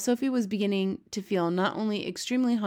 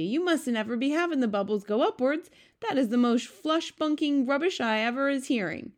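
high-pitched indignant protest; B F G, indignant giant; 7 semitones higher, 3 dB louder than usual. A narrator's reading. B F G corrects Sophie about bubbles, indignant. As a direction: scold in high-pitched disbelief.

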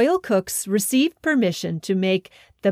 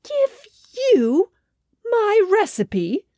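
higher, slow incredulous king; king appalled at the trolls' request; outraged disbelief; 10 semitones higher, slower than usual. A narrator's reading. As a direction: echo their request in shock. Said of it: "said the king".

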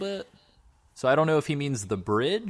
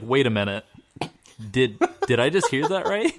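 normal talking voice

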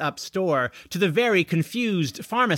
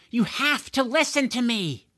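insistent appeal; 9 semitones higher than usual. A narrator's reading. Pleading with disobedient dragon.

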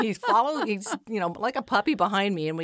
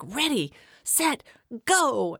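lightly voiced starter calling the kids off, a bit higher and drawn out; race starter call; 5 semitones higher, slower than usual. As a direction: call the race start to toddlers, slow and bright. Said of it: "and I was like".

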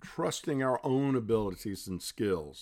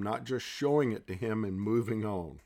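normal talking voice

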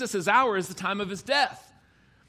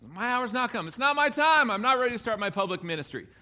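raised-pitch, hammed-up reluctant objection; mock protesting; 5 semitones higher than usual. Preacher playing unready Jesus to his mother, mock protest. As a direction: protest it like someone pushed before he is ready. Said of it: "thought that meant Jesus wasn't ready".

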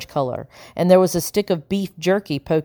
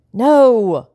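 moaning; 6 semitones higher, 6 dB louder than usual. A narrator's reading. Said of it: "Howard moaned".

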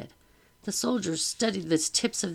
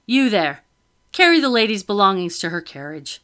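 loud order; 2 semitones higher, 8 dB louder than usual. A narrator's reading. Officer ordering a soldier: loud command. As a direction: call the soldier over firmly.

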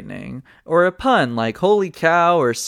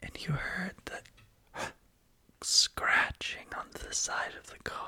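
whisper; 6 semitones higher, 11 dB quieter than usual. Acted. Telling scary story in whisper.

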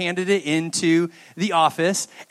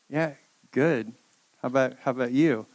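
guilty dodge; 6 semitones lower, 5 dB quieter than usual. Retold or imitated. Guilty son dodging mom's question.

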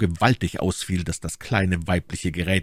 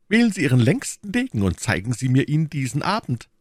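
put-on high, spirited young gallant; challenging young gallant; 7 semitones higher than usual. D'Artagnan reminding the Englishman of their quarrel, challenging. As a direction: needle him, pitched up.

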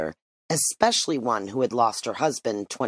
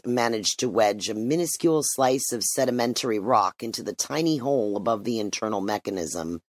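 usual speaking voice

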